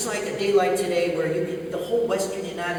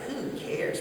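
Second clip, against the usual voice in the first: soft, higher mock eye-roll; dismissive; 5 semitones higher, 8 dB quieter than usual. Controller inwardly rolling his eyes at the Cessna, dismissive. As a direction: mutter it as a bored controller's private thought. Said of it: "you can just see the controller rolling his eyes".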